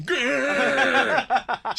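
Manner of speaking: loud, high playful growl; mock growl. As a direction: growl it out in fun. Mimicking scared child's face.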